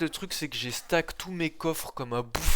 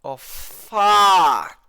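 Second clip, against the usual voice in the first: put-on loud, high English swearing in a showy accent; drawn-out English-accented yell, showing off; 5 semitones higher, 14 dB louder than usual. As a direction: belt out the long vowel in an exaggerated English accent. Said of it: "T'as vu cet accent un peu?".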